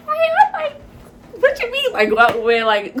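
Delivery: squeaky-high "what do you mean"; startled confusion. Retold or imitated. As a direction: blurt it out, baffled. Retells her startled confusion on waking.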